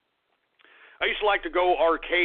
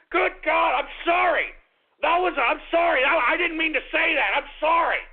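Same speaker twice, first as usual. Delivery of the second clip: panicked apology; 8 semitones higher than usual. Acted. Panicked after slip on air.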